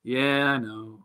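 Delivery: soft, resigned mock reply; imitating a sheepish, hushed admission. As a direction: concede it quietly, trailing right down. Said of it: "he kinda looks at me and goes".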